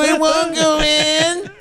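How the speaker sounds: high, slow, laughing panic; panicked dying soldier. Acted. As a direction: whine it while holding your guts. Same line repeated, higher.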